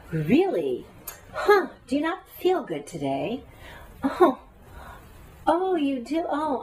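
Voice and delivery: high, mock-concerned put-down; cutting. Slicing someone with words about how they feel, acted cutting tone. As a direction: slice them with exaggerated false surprise. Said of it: "you're slicing people with your words".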